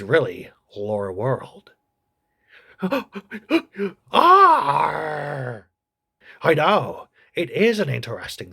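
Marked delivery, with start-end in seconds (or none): 2.8-3.9 creature gasp. Acted. Puppet creature reacts with gasp. 4.1-5.7 loud creature yell of Arr; creature arr cry. Acted. Agnew the creature answers host with loud Arr. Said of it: "You're right, Agnew".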